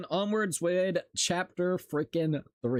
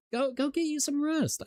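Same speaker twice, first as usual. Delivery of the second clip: high mock voice; 13 semitones higher than usual. Mock high voice telling self to rest.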